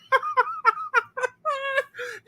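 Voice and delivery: long high wheezing laugh. Real, not acted. Still cracking up at the lunchroom memory, high laugh. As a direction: laugh till it squeaks. Laughing at his own story.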